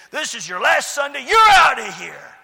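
angry yell building to a loud shout; mad landlord. Retold or imitated. Landlord evicting the little church, acted angry shout. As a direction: throw him out, high and loud. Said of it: "he was mad".

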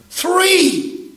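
high, loud incredulous THREE!; stunned disbelief at the number; shocked. Real, not acted. Incredulous at three-person lease.

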